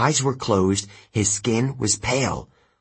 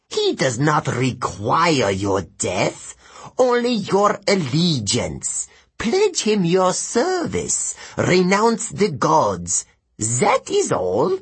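high, slow, commanding demon; hissing demon; 4 semitones higher, slower than usual. Acted. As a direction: press the boy to swear, word by drawn-out word. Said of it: "the first Telekine hissed".

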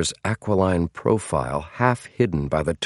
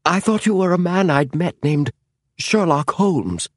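much higher, panicked gasp; 10 semitones higher than usual. A narrator's reading. James answering the man who grips him, gasping. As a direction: gasp it out high, wanting to get away. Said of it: "gasped James".

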